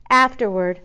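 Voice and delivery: usual speaking voice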